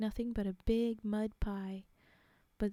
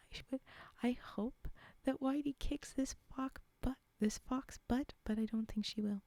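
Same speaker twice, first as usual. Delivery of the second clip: ordinary delivery, nothing marked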